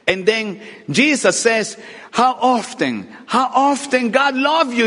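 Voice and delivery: high, driving preacher voice; preaching. Real, not acted. Preacher retelling the triumphal procession, high and driving. Preaches God's love at full force.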